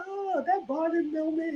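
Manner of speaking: high, slow, soft gushing. Retold or imitated. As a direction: coo over the bonding moment. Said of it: "I was like".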